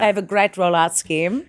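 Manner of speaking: South African mum nagging her son; bossy mother with a South African accent. Acted. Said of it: "that's such a good accent".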